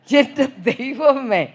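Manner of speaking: slow, laughing exclamation; amused laughing cry of my God. Real, not acted. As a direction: laugh it out in disbelief.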